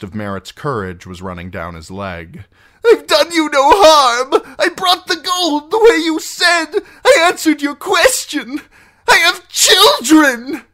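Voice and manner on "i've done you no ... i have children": very high, loud wailing plea; desperate begging. Acted. Condemned captive pleading for mercy, desperate wail. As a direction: plead frantically, near tears. Condemned man begs for life.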